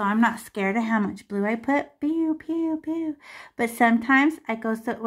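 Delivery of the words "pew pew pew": higher playful pew pew; voiced pew sounds. Shown as a demonstration. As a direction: chirp each pew playfully. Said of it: "demonstrating dabbing strokes".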